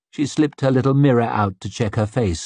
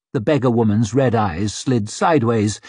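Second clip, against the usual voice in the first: ordinary delivery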